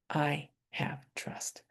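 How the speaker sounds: slow whisper, half voiceless; whispered affirmation. Real, not acted. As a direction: let "I have trust" fall to a slow whisper.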